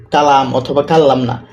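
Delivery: ordinary talk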